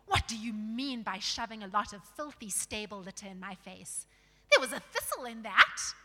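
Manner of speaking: loud, outraged scolding; angry shout. A narrator's reading. Dwarf protesting litter shoved in his face, shouted outrage. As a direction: burst out in loud indignation. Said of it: "he shouted".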